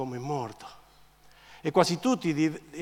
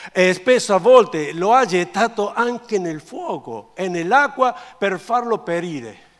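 loud, higher, slow dramatic reading; forceful scripture reading; 6 semitones higher, 13 dB louder, slower than usual. A narrator's reading. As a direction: read it out slowly and emphatically. Reads scripture aloud with force.

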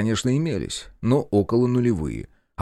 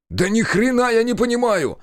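high, exasperated yell; breaking into a shout; 11 semitones higher than usual. A narrator's reading. Narrator yelling back at his brother, angry high shout. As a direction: lose your temper and yell back. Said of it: "сорвался на крик я".

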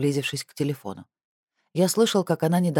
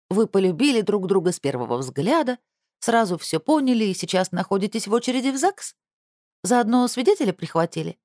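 teasing sister; 6 semitones higher than usual. Acted.

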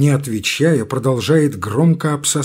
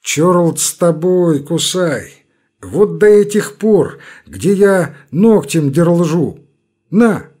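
grudging relent; 4 semitones higher, 6 dB louder, slower than usual. Acted. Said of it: "неожиданно смягчается".

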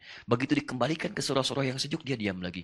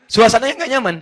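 emphatic; 10 semitones higher, 13 dB louder than usual. Real, not acted. Emphatic preaching.

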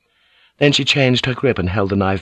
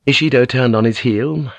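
ordinary delivery, nothing marked

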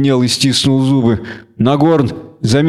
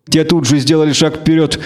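ordinary delivery, nothing marked

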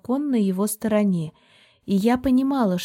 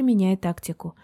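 ordinary delivery, nothing marked

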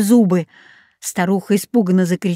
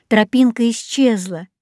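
plain reading voice

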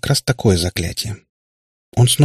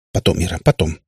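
slightly lowered, hurried brush-off; торопливо; 3 semitones lower than usual. A narrator's reading. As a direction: put the child off in haste. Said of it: "торопливо проговорил папа". (торопливо, отмахиваясь)

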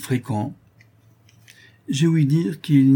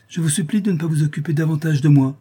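raised, curt and displeased; real displeasure; 7 semitones higher, 5 dB louder than usual. Acted. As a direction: make it clear he annoys you. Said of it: "dit-elle avec un vrai déplaisir".

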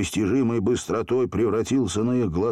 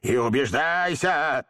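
slow, raised genie roar; 5 semitones higher, slower than usual. A narrator's reading. Genie proving his power to the boys, roaring. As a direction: bellow it slowly as a proud genie. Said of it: "заревел Джин".

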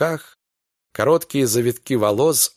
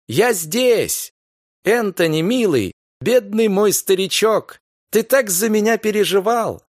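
joyful call; 8 semitones higher than usual. Acted. Gloria calls joyfully to husband.

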